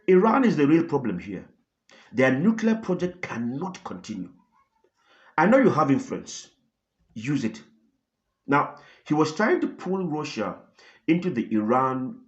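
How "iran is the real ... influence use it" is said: louder, firm push-back kept polite; gently but firmly. Retold or imitated. Israeli leader urging the Russian president, firm but gentle. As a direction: press the point without a fight. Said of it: "pushed back gently but firmly".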